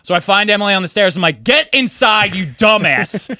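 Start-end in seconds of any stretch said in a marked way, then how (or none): 1.4-3.3 scolding yell. Retold or imitated. Scolding drunk friend on stairs.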